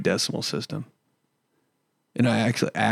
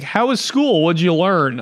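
much higher nagging dad voice; imitating dad; 5 semitones higher than usual. Dad grilling his son after school in a high mock voice. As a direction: pester like a chirpy parent. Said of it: "he'd be like".

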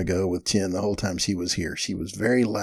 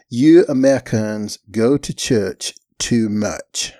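put-on slow, firm Chinese accent; each word placed deliberately in a Chinese accent; 4 dB louder, slower than usual. Student scolding her American hosts, slow Chinese accent. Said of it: "forgive my accent".

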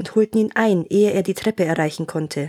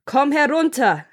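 raised-pitch call from below; Bluebeard's restrained storybook shout; 7 semitones higher than usual. A narrator's reading. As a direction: call the order up the stairs, pitched higher. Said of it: "rief Blaubart noch einmal".

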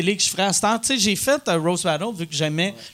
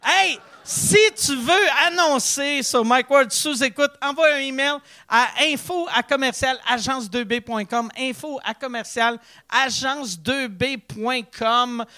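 exaggerated ad announcer voice; hammy radio ad read; 5 semitones higher than usual. Mock ad read for podcast.

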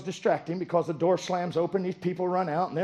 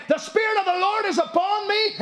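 proclaiming scripture; 12 semitones higher, 3 dB louder than usual. Retold or imitated. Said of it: "It said".

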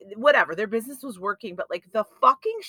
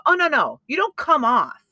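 loud, pointed comeback; retold angry retort; 5 semitones higher, 10 dB louder than usual. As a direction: cut her down hard and loud. Retold confrontation with rude chef.

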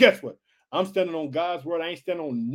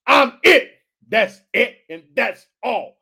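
voice of God; 4 semitones higher, 9 dB louder, slower than usual. Acted. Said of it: "What God said".

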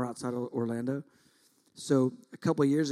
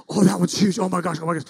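loud, excited mock freak-out; thrilled, higher and louder outburst; 4 semitones higher, 8 dB louder, faster than usual. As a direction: imitate a fishing guide flipping out. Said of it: "the guide starts freaking out".

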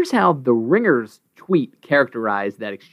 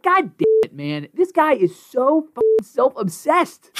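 high exasperated rant, slowing on the last line; fired up; 11 semitones higher, slower than usual. Real, not acted. [name] rants about Bill's ego, worked-up exasperation. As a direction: vent real annoyance. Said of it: "You're very fired up and emotional".

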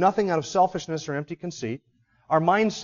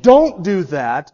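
"Don't" leaned on, slow and loud; stressed don't; 7 dB louder, much slower than usual. Shown as a demonstration. Preacher stressing "Don't" to the congregation. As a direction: hammer the "Don't" slowly. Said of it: "the emphasis is on".

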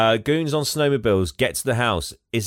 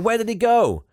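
high, bewildered mock cry; acted astonishment; 11 semitones higher, 3 dB louder than usual. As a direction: act out baffled amazement, pitched well up. Characters baffled by Santa's disappearance.

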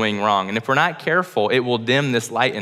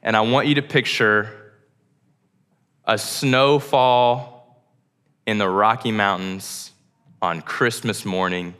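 guided imagery. Acted. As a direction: lead them gently into a picture. Leads a guided imagination exercise.

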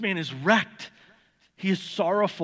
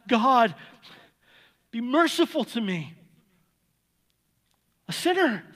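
loud, high desperate plea, voice straining upward; anguished cry for mercy; 5 semitones higher, 3 dB louder than usual. Acted. Preacher voicing the tax collector crying out for mercy, loud and high. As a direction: cry out to God like a broken man. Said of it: "That's all he can get out of his mouth".

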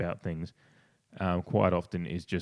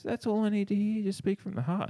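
much higher mock-satisfied voice; Rowie; 13 semitones higher than usual. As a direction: imitate someone getting the answer he wanted. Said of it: "Rowie goes".

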